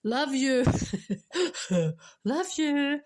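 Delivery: loud, high, laughing love you; retold shout. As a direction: blurt it out loudly while laughing. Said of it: "she shouted".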